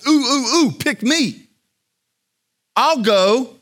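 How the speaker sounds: put-on high, loud eager kid volunteering; overeager. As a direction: ooh ooh, pick me, like a keen schoolkid. Isaiah as eager first grader.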